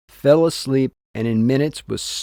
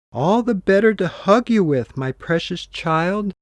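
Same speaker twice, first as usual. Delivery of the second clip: higher, honeyed fake granny voice; lying wolf as grandmother; 6 semitones higher than usual. Disguised wolf lies to the girl in a higher honeyed granny voice. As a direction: coax the child with false sweetness. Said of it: "lied the wolf".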